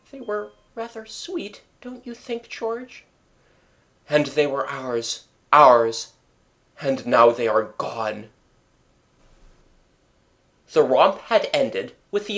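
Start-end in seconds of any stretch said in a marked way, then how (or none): 0.1-3.0 much higher and very soft; tender mother. A narrator's reading. Mother recalling children. 4.1-8.3 mournful, ours pressed louder; grieving father. A narrator's reading. Father grieving lost children.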